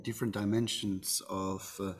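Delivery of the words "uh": ordinary talk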